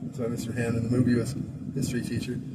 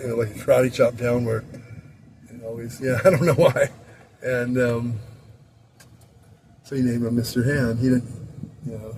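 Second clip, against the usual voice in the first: ordinary talk, nothing marked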